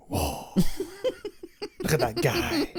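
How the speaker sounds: high, silly amazed voice, laughing; mock gawking voice. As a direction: gawk at the guy on the cup in a squeaky voice. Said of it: "I'm gonna do that".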